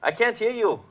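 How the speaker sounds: loud, high, straining to hear; shouting over noise. Retold or imitated. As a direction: yell like you can't hear a thing. Shouting over loud convention hall.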